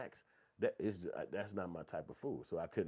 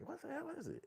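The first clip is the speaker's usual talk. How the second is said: much higher, soft incredulous question; baffled disbelief; 7 dB quieter than usual. Retold or imitated. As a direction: sound totally lost.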